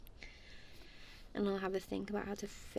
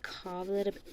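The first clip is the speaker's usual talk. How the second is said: normal talking voice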